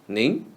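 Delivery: ning with a rising second tone; Mandarin second tone. Shown as a demonstration. Teacher modelling polite ning after melanin. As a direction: lift ning upward. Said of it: "read in the second tone".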